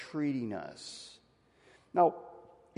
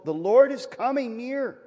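ringing proclamation; 10 semitones higher, 7 dB louder than usual. Acted. Preacher proclaims scripture.

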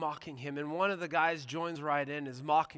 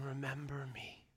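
whisper; 4 semitones lower, 14 dB quieter than usual. Retold or imitated. Thief pleads to Jesus on cross.

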